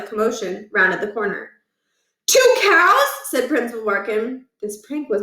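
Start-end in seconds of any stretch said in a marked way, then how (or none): 2.3-3.0 high, loud startled question; can't believe it; shocked disbelief. Acted. Scene: principal spots a second cow, stunned.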